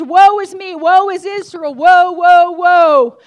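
loud, high preaching wail; crying out woe. Acted. Pastor acts Isaiah lamenting before the church, loud wail. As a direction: cry the woes out at full force. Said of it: "when he starts out crying".

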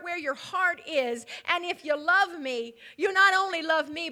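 normal talking voice

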